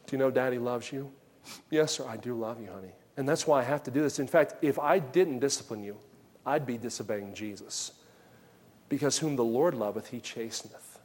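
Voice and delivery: low, soft reassurance; tender father. Retold or imitated. Daddy consoling child after discipline, soft and tender. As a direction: reassure the child quietly and gently.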